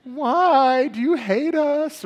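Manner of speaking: put-on high, loud, slow drawn-out teen complaint; whiny teenagers. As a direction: whine it like kids losing their phones. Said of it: "they would just lament".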